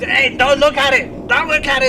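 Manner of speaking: put-on firm mother telling kid no; scolding mom. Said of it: "your mom just to pull you out of the toys".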